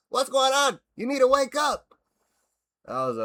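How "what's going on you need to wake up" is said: higher, louder mock coach bark. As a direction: ham up the coach shouting at her. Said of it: "he says".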